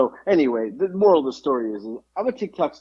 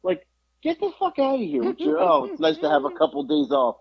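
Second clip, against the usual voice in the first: exasperated scolding; 7 semitones higher than usual. Retold or imitated. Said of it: "I was like".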